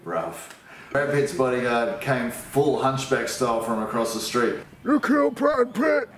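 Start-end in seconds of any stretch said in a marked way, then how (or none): 4.8-6.1 very high-pitched, strained cry; outraged accusation. Acted. Bodyguard accusing Uncle Barry, high-pitched outrage. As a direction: screech it in hammed-up horror.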